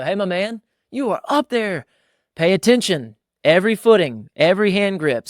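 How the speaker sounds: high, loud call up into the tree; calling up to son. Retold or imitated. Dad calling up to his son climbing a tree, high and loud. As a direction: project up to a boy high in the branches.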